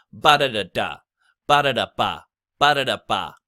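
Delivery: rhythmic BA-da-da-BA, middle syllables light; chanted stress pattern, BA and BA punched, da-da quick; stress on first and last. Shown as a demonstration. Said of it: "That's where the stress syllables are".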